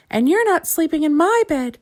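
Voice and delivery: squeaking little bear. A narrator's reading. Little Bear refusing Mister Lazy his bed, high squeak. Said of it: "squeaked the little bear".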